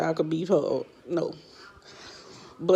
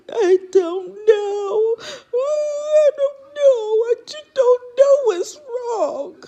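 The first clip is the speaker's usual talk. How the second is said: high, slow, wailing sob-talk; imitating daughter crying; 7 dB louder, slower than usual. Mom imitating her crying daughter Tootie, high wailing sobs. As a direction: mimic a bawling kid who can't explain herself. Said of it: "she bust out and started crying".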